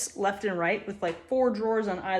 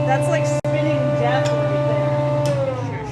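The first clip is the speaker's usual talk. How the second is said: slow, loud, half-scared awe; awed; 5 semitones higher, 6 dB louder, much slower than usual. Real, not acted. Maker watching the spinning blade of her new machine, slow awed. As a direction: draw it out in wide-eyed amazement.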